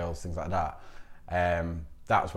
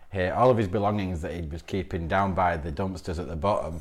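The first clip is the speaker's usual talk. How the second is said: normal talking voice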